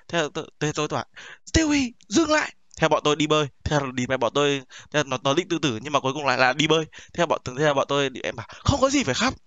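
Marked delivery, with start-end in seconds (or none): 1.5-2.5 high, slow urgent call to stop; retold shout ordering a friend to stop; stern command. Stopping a suicidal friend. 8.5-9.3 mock pep talk. Consoling a crying friend.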